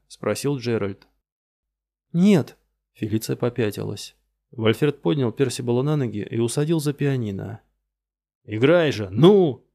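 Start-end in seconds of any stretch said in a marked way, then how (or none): 2.1-2.6 scared, loud no; frightened cry. Acted. Felicia refusing, frightened. 8.4-9.7 loud angry yell. Acted. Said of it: "орал он".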